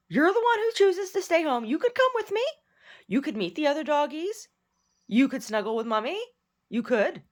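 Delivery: high-pitched cooing dog talk; talking to pet. Real, not acted. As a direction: coo to your dog in a high, singsong voice. Soothing her own dog.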